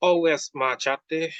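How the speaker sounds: slow, high careful French-lesson phrase, "ma chatte" said the French way; carefully spoken French from a lesson book; schoolbook French. A narrator's reading. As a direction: recite it like a French textbook line. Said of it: "first sentence in her French lesson book".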